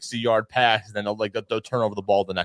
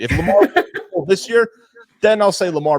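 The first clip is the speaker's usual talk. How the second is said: much higher and louder, laughing through it; giggling, pitched way up and louder while conceding Lamar; 11 semitones higher, 9 dB louder than usual. Real, not acted. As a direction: laugh your way through the concession, up high and loud. Teasing the Lamar fans, laughing.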